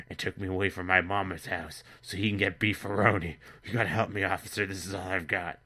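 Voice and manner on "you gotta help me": ordinary talk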